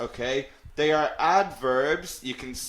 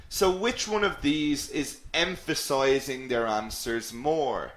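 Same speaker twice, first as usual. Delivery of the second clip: normal talking voice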